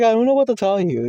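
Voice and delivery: high-pitched, quick protest; exasperated retelling. Retold or imitated. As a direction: throw it out high and fast. Retells his answer, louder.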